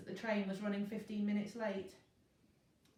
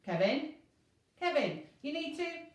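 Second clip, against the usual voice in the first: loud high urgent call; 8 semitones higher, 6 dB louder than usual. A narrator's reading. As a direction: call him, alarmed. Inspector calls the driver.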